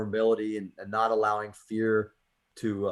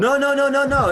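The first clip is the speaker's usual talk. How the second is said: a loud, much higher rush of no's; eager protest; 10 dB louder than usual. Real, not acted. Host loudly brushes off co-host's apology, excited. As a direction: wave off the apology with a burst.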